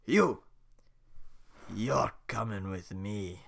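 loud then slow; boom, then drag him off slowly; Shrek growl. Acted. Shrek grabbing Donkey.

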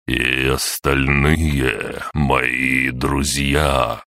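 very slow, soothing, unhurried; enveloping slow voice. Acted. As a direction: stretch each word out calmly. Tree elder addresses kneeling servants.

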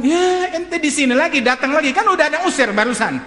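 loud-edged, high-pitched mock exasperation; exasperated scolding. Father sees the banished teacher back, exasperated scolding. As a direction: burst out high at the unwelcome returnee.

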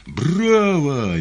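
loud, high-pitched drawn-out roar; frenzied bellow. A narrator's reading. Spectator high up cheering the stage, frenzied bellow. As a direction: bellow it wildly from the gallery. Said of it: "неистово рявкнул бас где-то в высоте".

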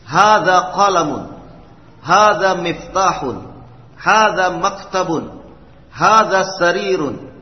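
loud, clear, deliberate Arabic vocabulary reading; model reading drill. Shown as a demonstration. Teacher modelling Arabic words for students, loud and clear. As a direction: read each phrase out crisply for the class to copy.

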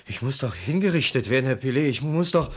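climbing frantic insistence; rising desperate plea. Acted. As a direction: beg him not to leave. Man pleading to be executed.

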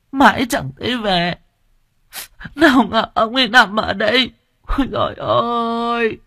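keen over her husband's body; wailing lament. Acted. Widow sobbing over what she thinks is her husband. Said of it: "bà khóc lóc vật vã".

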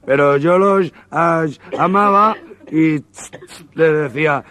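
high, slow, stammering voice with hissing stops; high put-on stutterer, very slow; stutterer's voice. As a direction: drag the words out, stuck on each start. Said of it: "el tartamudo".

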